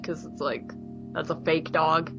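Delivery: ordinary talk, nothing marked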